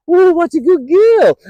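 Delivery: high, loud, sing-song praise; gushing dog praise. Retold or imitated. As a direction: gush over the dog like she's the best girl ever.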